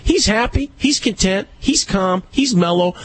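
high-pitched, insistent retort; emphatic vindication. Retold or imitated. Dog owner retelling his comeback to a critic, emphatic. Retold argument, driving his point home.

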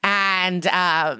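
normal talking voice

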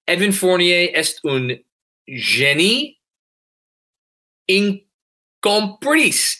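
halting English-accented French read aloud. Real, not acted. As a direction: sound out the French slowly. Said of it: "I'm gonna try to read it in French".

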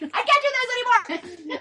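a mom at the end of her rope; exasperated outburst. Retold or imitated. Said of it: "And then I was like".

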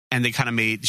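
usual speaking voice

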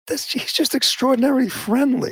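high, sarcastic parroting of the excuse; mocking quote. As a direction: mimic the spin with scorn.

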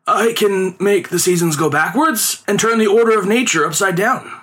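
lightly voiced showman boasting; boastful conjurer. A narrator's reading. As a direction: sell the impossible to the mandarins. Performer boasts of his magic.

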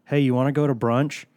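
normal talking voice

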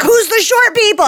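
high-pitched imagined guest asking; mock bewildered question. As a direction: ask it high, playacting confusion. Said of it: "they'd be like".

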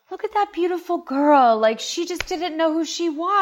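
high-pitched, wistful gushing over a photo; mock admiring. Acts out admiring old photos.

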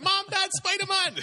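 put-on shrieking child's delight; excited kids. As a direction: scream it like a thrilled little kid seeing Spider-Man. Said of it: "They're like".

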